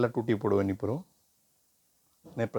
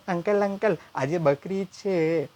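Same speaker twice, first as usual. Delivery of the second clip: put-on higher, very slow, wide-eyed boy; innocent kid voice; 6 semitones higher, much slower than usual. Nephew telling uncle the holiday, slow kid voice. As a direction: announce it like a proud little boy.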